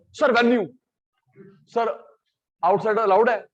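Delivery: mock eager student. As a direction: play the keen kid jumping in. Imitates eager students asking venue.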